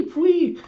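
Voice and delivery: very high squeaky pwee; cute mock squeal; piglet squeal. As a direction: imitate a tiny pig squeal.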